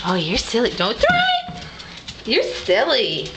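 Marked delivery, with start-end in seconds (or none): 0.7-1.6 much higher, sing-song no no no; high-pitched warning. Real, not acted. Warning toddler not to throw.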